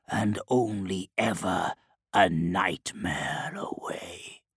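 low, slow, hushed demonic voice. Acted. Demon speaks through possessed man.

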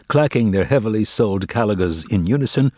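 usual speaking voice

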